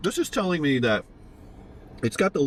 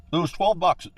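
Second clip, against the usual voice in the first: usual speaking voice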